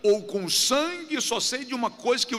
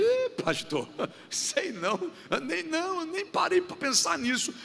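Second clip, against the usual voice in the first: higher, softer, hesitant shy voice; bashful congregant; 9 semitones higher, 4 dB quieter than usual. Retold or imitated. Preacher voicing a member asked his name's meaning, high shy hesitation. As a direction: play a shy member caught unprepared. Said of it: "Alguém vai dizer".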